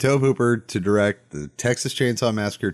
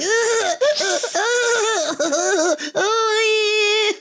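shrill maniacal cackling laugh; high cackle. Retold or imitated. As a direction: cackle like a lunatic hanging out a window. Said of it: "cackling is like".

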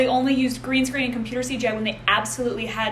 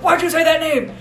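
shouting, rising to a yell; mock angry shout; 6 semitones higher, 4 dB louder than usual. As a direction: yell it while throwing punches. Batman punching, shouting the line.

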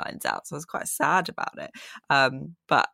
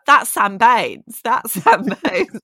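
mock shouting at TV; 7 semitones higher, 8 dB louder, slower than usual. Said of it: "I was shouting at my T V".

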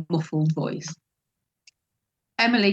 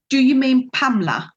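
loud, high start dropping steeply on the name; retold indignant question, punched out; pointed correction; 6 semitones higher, 5 dB louder than usual. Pointed correction after a long pause.

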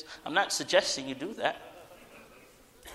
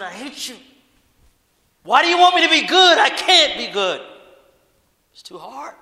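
much louder and higher, crying out in anger; angry outburst at God; 10 semitones higher, 12 dB louder than usual. Retold or imitated. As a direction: shout your despair up at God. Retold outburst against God.

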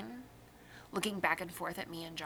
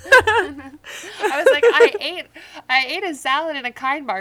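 loud, high laughing retelling; 10 semitones higher, 14 dB louder than usual. Real, not acted. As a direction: laugh through the story.

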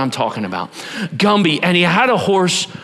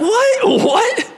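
mock disbelief; 3 dB louder than usual. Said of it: "You're like".

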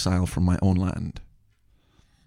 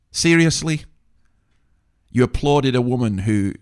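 higher and louder, aghast; incredulous; 3 semitones higher, 4 dB louder than usual. Real, not acted. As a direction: throw it out like you can't believe it. Reacting to applause in disbelief.

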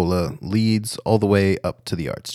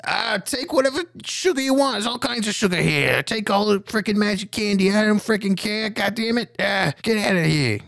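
much higher, panicked shopkeeper voice; frantic candy shopkeeper; 13 semitones higher than usual. Acted. Billy Bonka, cowering, begging Ma to take the sugar, high and panicked. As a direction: squeak it out in a terrified rush. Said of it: "Billy Bonka says".

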